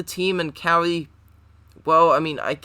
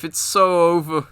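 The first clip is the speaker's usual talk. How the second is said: normal talking voice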